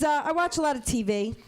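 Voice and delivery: ordinary delivery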